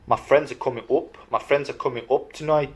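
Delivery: "up" said as "oop" in Northern English. Shown as a demonstration. Vlogger showing Northern speech, "up" said as "oop". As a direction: round "up" to a short "oo". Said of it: "you say up".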